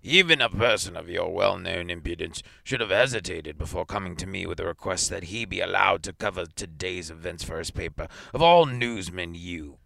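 a lightly voiced old man, scorn rising on all newsmen; furious old director. A narrator's reading. Director shaking the newspaper at Theremin, scornful fury. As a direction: spit the final you with contempt. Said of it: "shook it at Theremin furiously".

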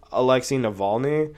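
usual speaking voice

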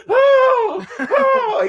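high, loud mock scream with a laugh. Camper shrieking in mock fear as the bear roars. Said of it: "He screamed".

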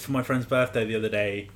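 usual speaking voice

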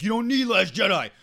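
high, loud mocking fanboy voice; whiny man-child defending the originals; mocking superfan. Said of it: "a lot of people are like".

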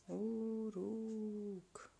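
drawn-out haunting call; a far-off voice on the wind; eerie call from afar; distant call. A narrator's reading. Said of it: "Își auzea numele strigat".

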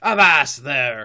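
loud, drawn-out pirate order; slow, forceful sea-captain shout; commanding cry. A narrator's reading. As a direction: halt a mutineer with a bellow. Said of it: "cried Silver".

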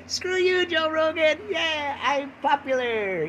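high-pitched hammed-up boasting; mock gloating. Podcaster gloats at beating Joe Rogan, mock triumph. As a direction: crow it, stretching out the last words.

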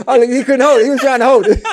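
high, laughing explanation; helplessly amused, words riding on laughter. Real, not acted. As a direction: explain through real giggles. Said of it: "He made me laugh".

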